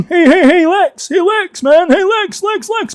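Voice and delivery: very high, insistent nagging voice; pestering friends. Retold or imitated. Friends messaging him as he plays, high-pitched pestering. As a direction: squeal for attention over and over.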